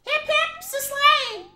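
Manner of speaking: very high, slow cartoon hurrah; squeaky mock cheer. Streamer mock cheering for Slade, very high.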